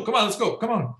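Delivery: high-pitched mock coaxing; imitated eager urging. Pilot urging a reluctant passenger aboard, imitated high and eager. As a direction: imitate a pilot hurrying someone along.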